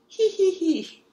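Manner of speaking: put-on hee hee hee, high toddler giggle. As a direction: giggle at bedtime. Giggle after good nights.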